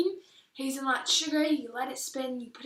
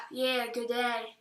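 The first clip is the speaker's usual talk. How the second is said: put-on broad Kiwi accent; 4 dB quieter than usual. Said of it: "That's more Kiwi than we sound".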